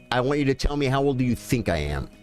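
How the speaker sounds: ordinary delivery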